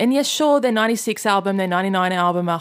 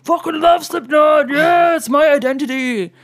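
loud, high hammed-up rocker shout; mock grunge fan; 8 semitones higher, 5 dB louder than usual. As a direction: shout it like a proud thirty-year-old metal fan. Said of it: "thirty-year-olds ... that are like".

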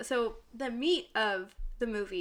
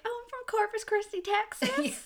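Annie; 12 semitones higher than usual. Retold or imitated. Friend quotes sitcom character using fake ID, high Texan accent. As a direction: imitate a fake Texas accent, pitched way up. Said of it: "episode in Community where".

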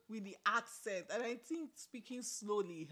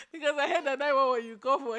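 laughing; 4 semitones higher, 9 dB louder, faster than usual. Real, not acted. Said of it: "laughing while talking".

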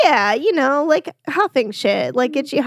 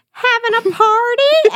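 high, weird banner voice; 11 semitones higher, 3 dB louder than usual. Retold or imitated. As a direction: read the banner in a silly high voice. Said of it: "say it in, like, that weird voice".

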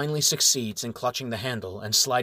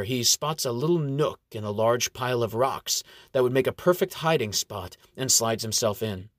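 usual speaking voice

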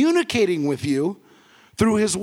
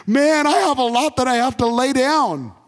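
higher-pitched imagined exclamation; emphatic exclamation; 6 semitones higher than usual. Retold or imitated. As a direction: voice Paul's big realization, pitched up. Voices Paul's burden in modern words.

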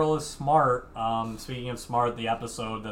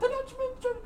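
ordinary delivery

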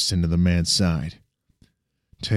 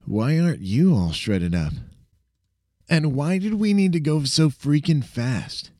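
put-on higher, amused young sidekick; cocky friend voice; 8 semitones higher than usual. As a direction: tease him, impressed but playful. Sam's voice, indignant.

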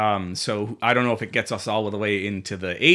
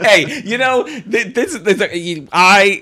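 talking through laughter; words bubbling with laughter; laughing; 9 semitones higher, 8 dB louder, slower than usual. Real, not acted.

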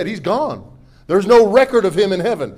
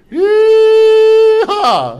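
whooping cowboy yell, much higher and louder; yee-haw; 14 semitones higher, 10 dB louder than usual. Acted. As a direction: let out a wild rodeo whoop. Joking about heavenly drinks.

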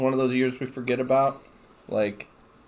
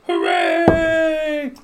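mock cheer; 4 dB louder than usual.

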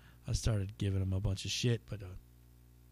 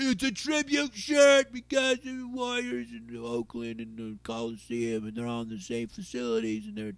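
higher mock-indignant heckler; mimicking the heckler; 12 semitones higher, 4 dB louder than usual. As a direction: play the defensive guy insisting on his shirt. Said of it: "he's like".

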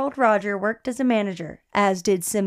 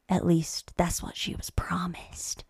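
slow, dramatic whispered reveal; ominous whisper; 5 semitones lower, 9 dB quieter than usual. Acted. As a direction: whisper it like a dark twist. Ominous twist in the story.

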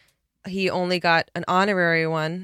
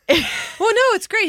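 ordinary talk, nothing marked

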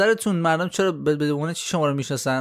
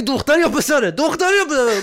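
high-pitched, repeated mock query; mock insistent questioning; 8 semitones higher than usual. Asking the father boy or girl yet again, mock insistent. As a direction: play it dense, pitched high and pressing.

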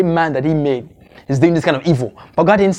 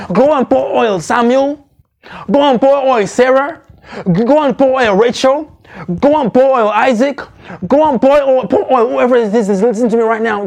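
full-force preaching; 5 semitones higher than usual. Acted. Preacher calls on the church to pour their oil, in full flight. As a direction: drive each command home to the congregation.

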